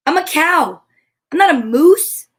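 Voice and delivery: high, loud indignant cow. A narrator's reading. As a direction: insist you are a cow. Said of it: "said the cow".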